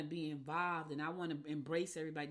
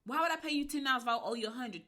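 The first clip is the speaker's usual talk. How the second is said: ordinary delivery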